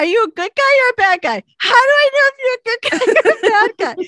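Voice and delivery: high, loud, rapid-fire demand; hammed-up panic, shouting questions fast; panicked challenge. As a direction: imitate a frantic player confronting a stranger. Retelling friend's panicked challenge to stranger.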